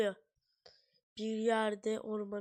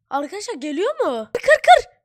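frantic high screaming; panicked yelling; 9 semitones higher, 15 dB louder than usual. Real, not acted. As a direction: yell in panic while fighting. Fighting the monster in panic.

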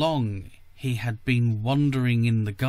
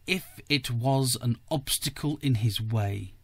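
ordinary delivery

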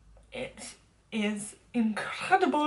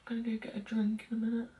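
whisper; 10 dB quieter, faster than usual. Real, not acted. Whispered aside while leaving.

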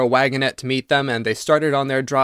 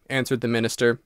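usual speaking voice

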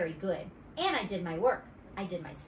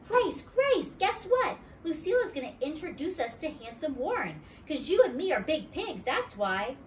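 put-on excited kid call; 7 semitones higher than usual. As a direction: burst out to your friend. Junie B. excited news.

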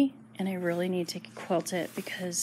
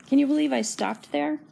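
usual speaking voice

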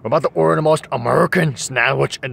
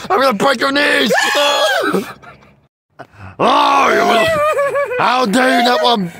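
screaming rage, high and loud; shaking with anger; furious yelling; 12 semitones higher, 3 dB louder than usual. Acted. Parent yelling at son over lettuce.

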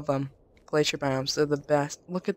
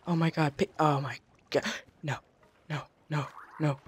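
ordinary talk, nothing marked

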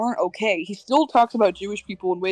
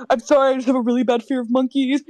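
quick, slightly higher nervous plea; mock scared; 4 semitones higher, faster than usual. Telling the zookeeper about her monkey fear, quick nervous plea. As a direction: rush it out like you're scared. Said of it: "And I'm like".

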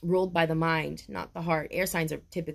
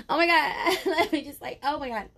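overwhelmed crying; 8 semitones higher than usual. Real, not acted. Reader overwhelmed by the cards, crying out. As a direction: let the emotion break through.

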